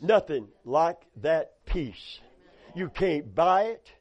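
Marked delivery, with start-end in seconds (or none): none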